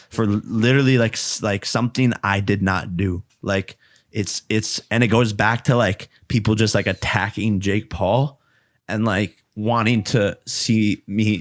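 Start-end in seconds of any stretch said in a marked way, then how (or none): none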